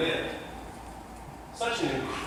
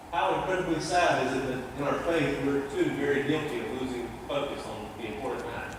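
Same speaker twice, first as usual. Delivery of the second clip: usual speaking voice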